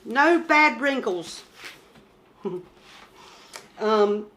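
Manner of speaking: ordinary talk, nothing marked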